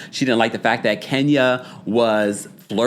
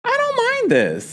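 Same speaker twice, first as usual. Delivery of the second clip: surprised approval. Real, not acted.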